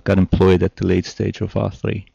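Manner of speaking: normal talking voice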